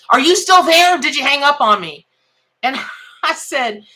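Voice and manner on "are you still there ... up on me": impatient caller. Retold or imitated. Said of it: "he was like".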